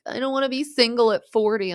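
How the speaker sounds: mock lament. Retelling Schwartzy at the demolition, mock lament.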